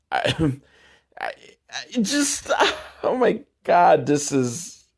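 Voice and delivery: laughing in disbelief; helpless laugh at the film; exasperated laughing. Real, not acted.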